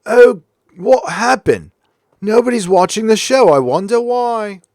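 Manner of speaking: mocking BBC. Streamer mocks the BBC, hammed-up clueless voice. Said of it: "they're gonna go".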